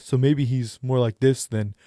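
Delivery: character voice placed forward in the mouth. Shown as a demonstration. Game master demos an Earth Genasi voice, forward in the mouth. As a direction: push the words to the front of the mouth. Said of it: "a little bit more forward in the mouth".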